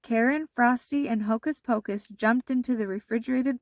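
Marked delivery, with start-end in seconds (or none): none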